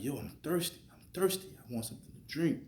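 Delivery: frantic thirsty plea; desperate whine. Retold or imitated. Retold frustrated thirst in dream.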